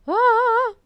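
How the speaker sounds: short, high, loud cry of joy; happy squeal. Real, not acted. Very happy fan before resuming episode, squealing. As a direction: let out a pent-up squeal. Happy outburst after doll news.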